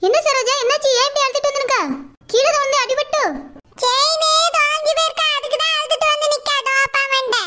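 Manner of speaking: a lightly voiced older neighbour, teasing; neighbour Rosa. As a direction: play the nosy neighbour, half mocking.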